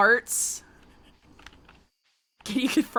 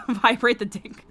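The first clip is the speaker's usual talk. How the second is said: usual speaking voice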